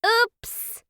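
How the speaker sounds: high, loud little oops; child's oops. A narrator's reading. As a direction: pipe up like a kid who spilled something. Child spills the cereal.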